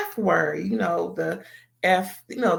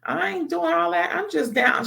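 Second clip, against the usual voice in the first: much higher, startled protest; surprised denial; 11 semitones higher than usual. Retold or imitated. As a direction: deny it, caught off guard. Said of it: "And she was like".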